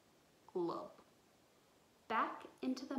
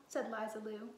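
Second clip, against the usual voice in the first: ordinary delivery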